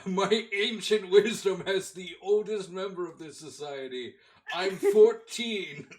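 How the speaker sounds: high, slow mock elder voice; ancient wise elder. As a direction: drag it out like an ancient sage. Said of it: "Your, your wisdom is immense".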